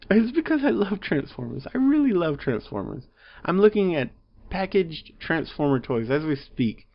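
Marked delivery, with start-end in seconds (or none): none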